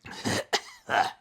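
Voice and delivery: short sneering laugh; a villain's cold chuckle; cruel laugh. A narrator's reading. Burn laughs.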